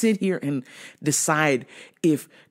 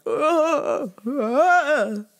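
mock whimper, pitched higher; crocodile tears; 10 semitones higher than usual. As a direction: fake a pitiful sob. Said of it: "The crocodile fucking tears came".

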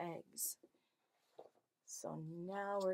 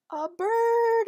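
much higher and louder, thrilled; excited reveal; 12 semitones higher, 12 dB louder than usual. Acted. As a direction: burst out with delight for the kids. Reveals animal behind door.

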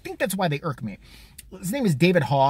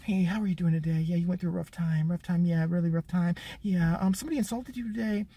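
soft, coddling interviewer voice; mock gentle interviewer; 5 dB quieter than usual. CNN host coddling the Parkland kid, soft and sweet. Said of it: "They're like".

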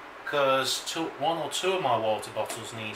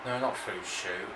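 ordinary talk, nothing marked

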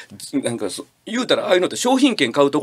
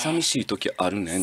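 lower, softer: 寂しい時あるねん with a sigh in 寂; soft sad confession; 7 semitones lower, 7 dB quieter than usual. Shown as a demonstration. Showing her how to say it, quietly and softly. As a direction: breathe the line quietly like an autumn confession. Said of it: "言うてみ".

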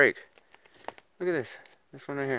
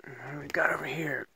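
hushed whisper; 4 semitones higher than usual. Real, not acted. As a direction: whisper so nothing hears you. Sneaking through bigfoot woods.

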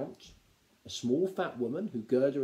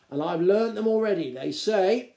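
higher, louder, proud showing-off; pleased and boastful; 7 semitones higher, 7 dB louder than usual. A narrator's reading. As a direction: present your prize find. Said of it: "he said proudly".

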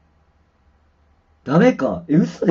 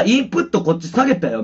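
ordinary talk

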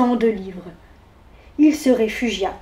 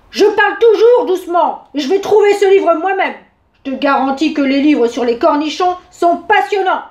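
loud, higher indignant bear; outright shouting; 4 semitones higher, 5 dB louder than usual. A narrator's reading. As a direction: shout it, easing slightly towards the end. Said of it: "l'ours cria carrément".

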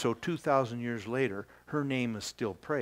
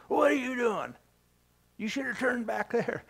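much higher, mocking a cheeky backseat driver; snippy tone; 10 semitones higher than usual. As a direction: play the pipsqueak scolding God, hammed-up. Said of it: "get that tone of voice out of your".

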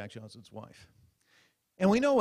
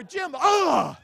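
higher, loud shouted name; shouted cue; 12 dB louder than usual. Retold or imitated. Comic imagines reporter shouting to anchor, loud high. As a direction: bark the name then gasp.

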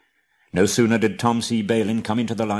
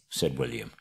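ordinary delivery

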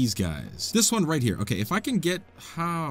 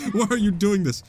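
laughing; 7 semitones higher, 3 dB louder than usual. Real, not acted. Laughs at the spinning object.

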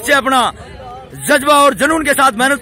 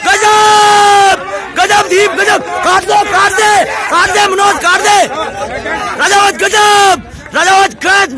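shouted pull commands; 5 semitones higher, slower than usual. Real, not acted. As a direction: urge the team to pull, hollering. Coach shouting pull commands to team. (ज़ोर लगवाने की ऊँची ललकार)

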